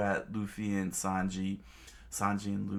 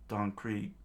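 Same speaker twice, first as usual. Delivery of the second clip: plain reading voice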